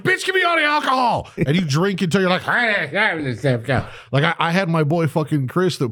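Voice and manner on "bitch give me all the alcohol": high, hammed-up demand for booze; kicking the door open, mock shouting for alcohol. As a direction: barge in demanding all the alcohol.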